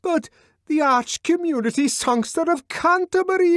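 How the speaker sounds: high, loud pleading; pleading shout through the door; near-tearful shout. A narrator's reading. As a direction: shout it close to tears. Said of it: "Bernard was almost in tears".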